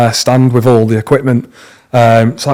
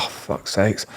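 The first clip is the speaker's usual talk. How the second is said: much softer, lower, sunk disappointment; deflated groan; 5 semitones lower, 15 dB quieter, slower than usual. Retold or imitated. Tattooist retelling hearing that MTV scrapped his pilot, deflated. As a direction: let the air out of you.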